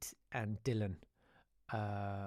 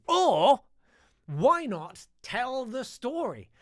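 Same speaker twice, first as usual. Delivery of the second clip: high, loud and drawn out; hammed-up plug; 11 semitones higher, 9 dB louder, much slower than usual. Real, not acted. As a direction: sell the idea slowly, pitched right up.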